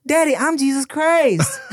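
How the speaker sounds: put-on high, naive child voice; innocent little boy. Little boy answering his angry dad, sweetly naive. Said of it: "And he was like".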